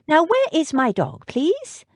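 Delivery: can't wait to see her dog; a touch of an impatient young lady; eager Jane. Acted.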